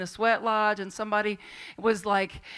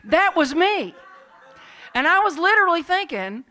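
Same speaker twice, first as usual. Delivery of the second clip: loud, high-pitched exclamation; emphatic confession; 8 semitones higher, 13 dB louder than usual. Real, not acted. Speaker confessing to being that someone, loud exclamation. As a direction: own up to it at full volume.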